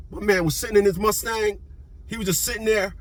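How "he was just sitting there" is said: usual speaking voice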